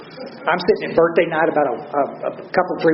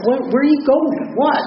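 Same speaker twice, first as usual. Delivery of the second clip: higher, slow, urgent calling after her; head her off at the door, much higher; startled calling out; 9 semitones higher, slower than usual. Retold or imitated.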